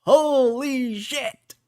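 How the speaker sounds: high, slow drawn-out amazement; mock crowd gasping holy shit; amazed crowd. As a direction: stretch it out in awe, up high. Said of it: "everyone starts coming in like".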